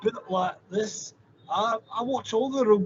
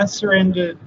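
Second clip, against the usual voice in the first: loud proclamation; 10 dB louder than usual. Acted. Dramatic confession.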